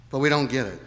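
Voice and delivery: ordinary talk, nothing marked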